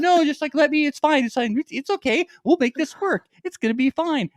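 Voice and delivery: high, insistent mock cashier voice; imitating cashier. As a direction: clerk insisting the receipt will work, high mock voice. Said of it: "She's like".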